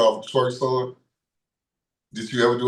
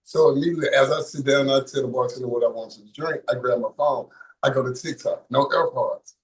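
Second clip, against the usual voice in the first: ordinary delivery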